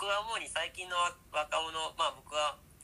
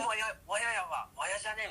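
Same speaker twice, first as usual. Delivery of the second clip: excited Hiroshima slang; 6 semitones higher than usual. Shown as a demonstration. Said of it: "みたいな、そんな感じの".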